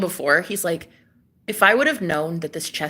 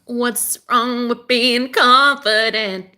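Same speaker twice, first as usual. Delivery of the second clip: sassy drawl; 4 semitones higher, 4 dB louder, much slower than usual. Acted. Catchphrase repeated, drawn out.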